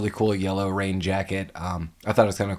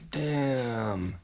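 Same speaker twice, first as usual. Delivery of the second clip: soft, drawn-out curse; Raphael; 2 semitones higher, 7 dB quieter than usual. Retold or imitated. Imitating the turtle who lost his sigh, soft drawn-out curse. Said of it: "Raphael goes".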